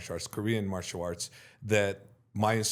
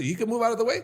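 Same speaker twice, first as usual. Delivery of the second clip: high-pitched, louder disbelieving question; incredulous brother; 12 semitones higher, 5 dB louder, much faster than usual. Retold or imitated. Brother doubting the story, high incredulous question.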